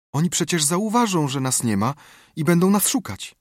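put-on high, anxious little girl; a scared girl asking for hope; frightened girl voice. Becky frightened in dark cave.

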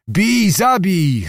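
a restrained roar, stretched slowly; mild mock bellow. A narrator's reading. Zagłoba hacking blindly at the enemy, mild bellow. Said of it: "ryczał jak bawoł".

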